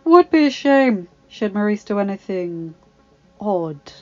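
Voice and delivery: loud opening, dropping on the last word; hint at trouble with a pause before the end; lightly voiced smug menace; dark veiled threat. A narrator's reading. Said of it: "he said darkly".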